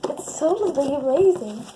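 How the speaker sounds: loud, gushing mock slime vlogger; over-the-top insistence. Skit vlogger defends rough slime play, loud and insistent. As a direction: insist it loudly, overdoing the enthusiasm.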